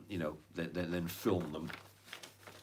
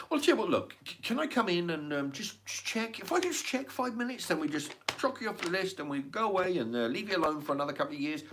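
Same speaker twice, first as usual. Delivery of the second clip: wheedling inspector; 8 semitones higher than usual. Retold or imitated. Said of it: "and they say".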